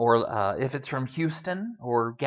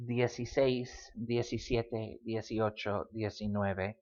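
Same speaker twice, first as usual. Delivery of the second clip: each number run together as one word, softer and lower; run as single words; 4 semitones lower, 7 dB quieter than usual. Shown as a demonstration. Teacher showing teens can combine, said as single words. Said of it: "can combine into a single word".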